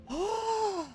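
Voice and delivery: long, high-pitched intake of breath; high gasp. Real, not acted. Streamer recovering from a startle, high gasp. Catching breath after the jolt.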